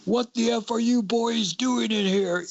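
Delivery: dad barging in on the boys, mock angry yell. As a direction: play the furious dad bursting in, half-mocking. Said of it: "and he was angry".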